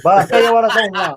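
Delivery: emphatic New York customer. Pretend. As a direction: lay down terms in a New York accent. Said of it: "New York voice and go and buy".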